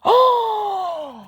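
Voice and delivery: high, sharp gasp of surprise; astonished intake of breath; shocked gasp. Real, not acted. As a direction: gasp loudly at a stunning reveal. Shocked by the card drawn.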